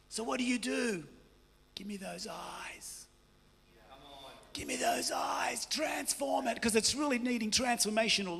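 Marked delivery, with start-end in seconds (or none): 1.7-3.1 much softer and slow, almost breathed. Acted. Preacher asks for tender gaze. 4.5-5.6 a voiceless whisper, intimate. Acted. Preacher repeats request.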